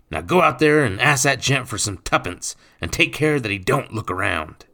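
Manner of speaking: loud, high-pitched bossy command; barked order. A narrator's reading. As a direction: send the boy off with firm orders. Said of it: "Nathan barked".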